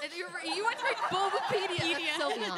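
high, loud giggly teasing; laughing teasing. Real, not acted. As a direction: tease a friend mid-laugh. Teasing friend while laughing.

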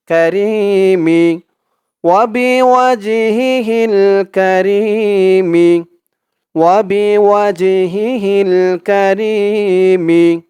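আউযু and Billah chanted slowly; drawn-out chant for repeating; slow recitation. Shown as a demonstration. Teacher leads students in slow chant. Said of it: "তো আমার সাথে বলুন".

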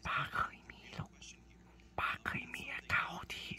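hushed confiding whisper; secret whisper. Acted. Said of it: "อย่าบอกเขานะ".

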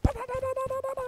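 high, rapid-fire "ba-da-da"; imitated gunfire. Player mimicking enemies pulling up shooting, imitated gunfire. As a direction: rattle off mock machine-gun noise. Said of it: "be like".

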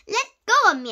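protest. A narrator's reading. Boy trying to shake his arm loose, protest.

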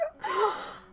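high, tearful gasps; sobbing gasp. Real, not acted. Viewer undone by the music, sobbing gasps. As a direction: gasp for air between sobs. Crying after music cue.

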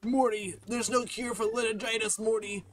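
Rick impression. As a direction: scream at Morty like a panicked mad scientist. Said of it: "I'm Morty. I'm Rick".

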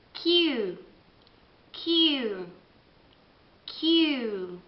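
Q said as kyoo; English Q. Shown as a demonstration. Teacher drilling English Q. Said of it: "la Q".

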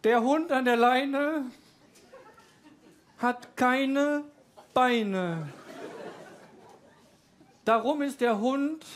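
slow, solemn poetry reading with a giggle; mock poem recital. Comedian reading his own silly poem aloud, hammed-up solemn. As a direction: recite it like serious art. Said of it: "das Gedicht heißt".

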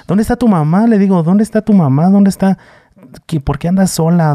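put-on high, urgent questions to a small girl; alarmed adult to lost girl. Dreamer asks the ghost girl where her mother is, high and urgent. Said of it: "Yo le decía".

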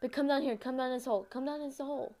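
ordinary talk

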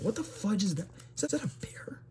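high, thin, hushed disbelief; high-pitched bewilderment; baffled. Real, not acted. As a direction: squeak out a puzzled question under your breath. Puzzled by an odd drawing.

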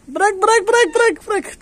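bırak squealed up high, again and again; high frantic pleading. Real, not acted. Owner begs the cat to let go, high squeal.

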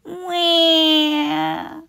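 high mock baby cry "wah"; baby wail. Crying baby on the plane as it lifts off, high wail. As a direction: wail like a fussy infant on the plane. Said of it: "listened to the crying baby".

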